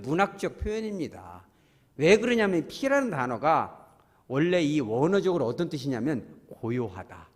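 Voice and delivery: ordinary talk, nothing marked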